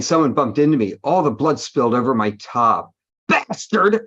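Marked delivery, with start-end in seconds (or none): 3.3-4.0 angry shout. A narrator's reading. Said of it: "I shouted angrily".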